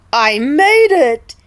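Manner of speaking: loud, slow triumphant cheer; happy oink. Acted. King Pig safe under his bed, loud happy cheer. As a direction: gloat out loud with relief. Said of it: "oinked happily".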